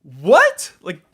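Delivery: high, loud startled "What?" then incredulous; shocked disbelief. Retold or imitated. Player reacting to his idol's out-of-tune uke, incredulous and shocked. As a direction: blurt it out in disbelief. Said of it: "And I was like".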